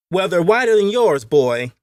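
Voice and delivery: put-on high, loud boy's comeback; young master's retort. A narrator's reading.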